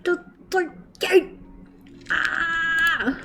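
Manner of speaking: high, slow, soft begging; pleading coo. Real, not acted. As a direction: streamer begs to have the viewer's puppy, high and slow. Begging for viewer's puppy.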